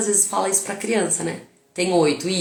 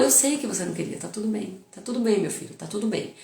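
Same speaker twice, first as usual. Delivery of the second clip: softer and softer, tender comfort; soothing reassurance; 6 dB quieter than usual. Retold or imitated. Mother comforting her sobbing son, softly reassuring. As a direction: hush a crying child. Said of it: "Eu falo".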